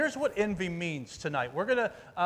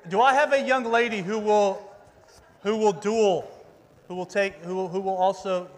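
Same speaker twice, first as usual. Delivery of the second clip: loud, raised call to the crowd; 3 semitones higher, 9 dB louder than usual. Real, not acted. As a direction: project to find a volunteer. Calls into crowd for volunteers.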